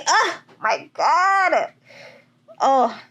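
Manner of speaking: slow, high, choked-up gasp of joy; overwhelmed. Real, not acted. Overcome by her own news, slow and high. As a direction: let it drag out, near tears. Overwhelmed, trying not to cry.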